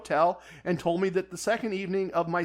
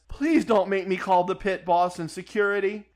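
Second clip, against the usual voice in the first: ordinary talk, nothing marked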